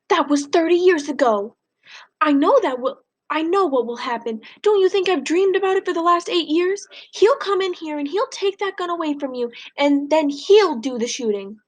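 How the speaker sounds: high, pleading, fearful voice; grandma warning her husband, high and afraid; frightened grandmother. Acted. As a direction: plead with mounting fear. Grandma's fearful speech in the novel.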